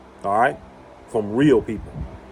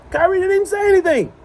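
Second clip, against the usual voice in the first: much higher, outraged disbelief; indignant; 3 dB louder than usual. Real, not acted. Defending Kyrie to viewers, high indignant outburst. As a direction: cry out how unfair it is.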